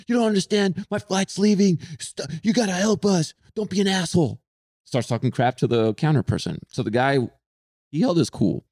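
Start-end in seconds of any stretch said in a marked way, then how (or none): 0.1-4.4 high, frantic mock rant; angry demanding. Traveler insulting the counter agent, high mock rant. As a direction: berate the agent like a spoiled traveler. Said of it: "he goes".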